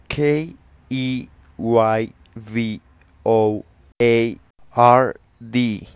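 very slow, letters rising in volume; spelling out. Shown as a demonstration. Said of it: "en inglés es keyboard". (deletreado muy lento y cada vez más fuerte)

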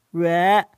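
蕊 echoed as the Xiaogan 月; repeating dialect sound. Shown as a demonstration. Child repeats the Xiaogan sound. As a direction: try 蕊 after grandpa. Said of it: "啊".

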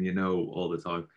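ordinary delivery

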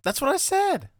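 very high-pitched, indignant comeback; mock-defensive protest. Real, not acted. Claiming the right name after failing, high and defensive. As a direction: squeak it out in protest. Pretends the wrong guess was right.

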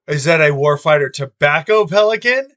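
excited surprise. Real, not acted. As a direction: gasp at a treasure and shout the question. Spots the prized cigar case.

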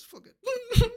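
very high, squeaky imitation sob; mock crying. Movie fan reliving letting the tears go, mock crying squeal. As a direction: squeal it out as if tears are flowing. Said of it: "I just let the tears go".

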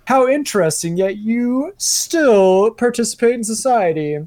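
high, loud, smug know-it-all; smug meme guy. Retold or imitated. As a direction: sneer it like a self-satisfied troll. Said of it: "the same guy's like".

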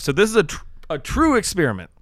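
talking through hiccups; words broken by hiccups. Real, not acted. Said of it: "Excuse me, I got the hiccups".

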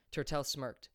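usual speaking voice

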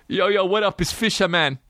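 lightly hammed-up rapper greeting, pitched high; mock Wu-Tang member. Comic teasing his hat-wearing buddy as a mock rapper. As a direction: play a mock hip-hop crew member. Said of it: "member of the Wu-Tang Clan".

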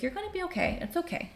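motherly comfort. Retold or imitated. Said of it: "she has this very beautiful motherly energy".

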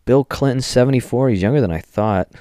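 ordinary delivery

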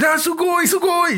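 squealing high sugoi, like a thrilled otaku; excited anime fan. Retold or imitated.

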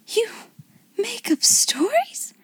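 breathless, high, slow astonishment; awestruck, with a pause after the first word; gasped amazement. A narrator's reading. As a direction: gasp it out haltingly, rising high. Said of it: "she gasped".

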